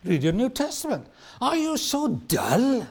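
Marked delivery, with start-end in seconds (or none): 1.4-2.9 slow, higher, cutting reproach; sharp rebuke. Retold or imitated. Jesus rebuking his disciples, slow and cutting. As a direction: let the rebuke sting. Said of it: "that's a bit, bit sharp".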